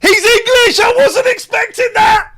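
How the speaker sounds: loud shocked outburst; surprised shout. Real, not acted. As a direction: shout it in disbelief.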